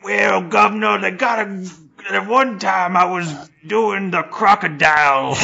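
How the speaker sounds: high, loud mock Cockney homeless bloke; Cockney accent. Imitating a homeless Brit in a Cockney accent. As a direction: ham up a Cockney voice, governor and all. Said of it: "like, a Cockney accent".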